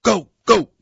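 loud coach yelling from across the rink; coach shouting. A narrator's reading. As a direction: bark it like a coach driving a tired player. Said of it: "Coach Fraser's shouts".

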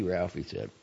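plain reading voice

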